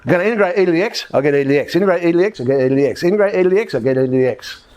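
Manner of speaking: fast singsong, up on each step, down on each answer; boring repetition. Shown as a demonstration. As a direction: reel it off like a rote routine. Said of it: "gonna be a bit boring".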